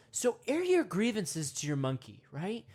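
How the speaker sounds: normal talking voice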